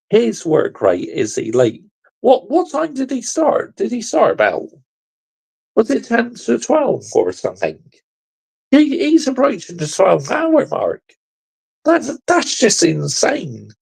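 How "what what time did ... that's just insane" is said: high, astonished disbelief; amazed. Real, not acted. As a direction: marvel at how long he's gone. Host amazed at long stream.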